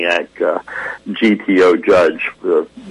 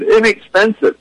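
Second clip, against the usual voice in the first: mock-refined southern Inexpensive, pitched up; genteel southern; 14 semitones higher than usual. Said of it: "down south, we're a little more genteel".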